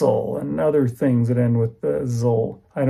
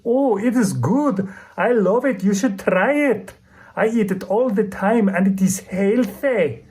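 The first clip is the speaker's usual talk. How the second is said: imitating a woman's strong Eastern European accent, pitched higher; a self-admittedly bad Eastern European accent, slow and higher; 7 semitones higher than usual. Retelling a shopper praising sauerkraut in mock Eastern European accent. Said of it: "I didn't do a very good accent".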